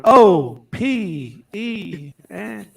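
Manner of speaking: drawn-out letters; slow spelled out. Retold or imitated. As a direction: spell each letter slowly. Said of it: "Sparky's now going".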